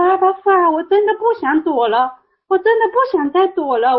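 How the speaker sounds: high, pleading surrender; retold tearful prayer. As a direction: re-enact crying out in prayer, pitched high. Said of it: "一边喊一边哭". (转述哭喊祷告，高声恳求)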